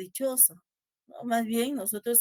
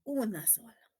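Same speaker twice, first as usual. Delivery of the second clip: whispered; 8 dB quieter than usual. Real, not acted. Teacher echoes student's answer.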